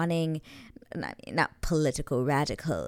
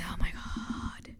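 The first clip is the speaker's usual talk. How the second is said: slow swooning whisper; breathless whisper; 2 semitones higher, 6 dB quieter, much slower than usual. Real, not acted. Swooning to viewers over the scene, whispered. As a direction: whisper it, overcome.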